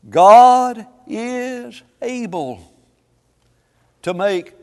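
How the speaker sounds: loud, slow, swelling preacher's cry; preaching at full force. Acted. Preacher reading scripture to his congregation, loud and drawn out. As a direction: lean into every word like a pulpit climax.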